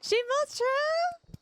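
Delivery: high, slow shocked squeal; stunned disbelief, stretched out high. Real, not acted. Reacting to chat, stunned and slow.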